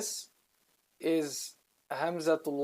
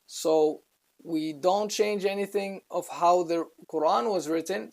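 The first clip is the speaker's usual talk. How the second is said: ordinary talk, nothing marked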